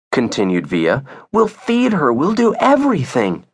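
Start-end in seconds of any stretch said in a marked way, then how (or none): 1.3-3.4 put-on high, insistent child's promise; pleading child. Via offering her mom anything, pleading child.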